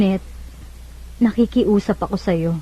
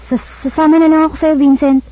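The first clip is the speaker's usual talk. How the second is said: urgent insistence; 6 semitones higher, 5 dB louder than usual. Acted. Ivy insists on eloping.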